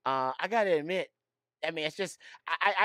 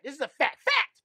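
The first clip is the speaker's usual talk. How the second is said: much higher, louder, pleading; mock insistence; 10 semitones higher, 4 dB louder than usual. Real, not acted. [name] insisting to laughing cohosts it's fact, high pitched. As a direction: squeal the last word up high. Insisting Putin theory is true.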